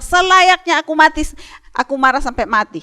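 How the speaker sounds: mock angry shout, half-hammed for kids; angry Jonah. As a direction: fire it back like a furious sulking prophet. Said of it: "Yunus bilang".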